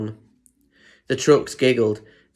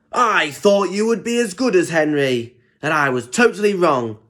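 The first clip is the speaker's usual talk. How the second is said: higher, stern telling-off; a scolding boss, mildly voiced; cross; 7 semitones higher, 4 dB louder than usual. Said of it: "looked down at Diesel crossly".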